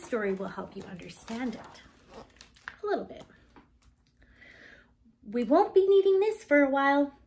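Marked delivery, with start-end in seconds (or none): 5.3-7.2 brighter, louder mother voice; cheery mom setting things aside; louder, lightly voiced mom; mother's voice. A narrator's reading. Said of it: "said Layla's mother".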